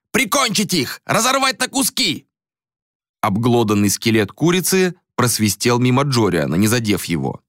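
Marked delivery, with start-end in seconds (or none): 0.1-2.3 mob shout. A narrator's reading. Mob leader urges a lynching.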